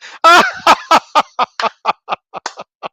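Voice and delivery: loud high helpless laugh; real laughter, loud and high; cracking up at a chat comment; high burst of laughter. Real, not acted. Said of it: "thank you for making me laugh".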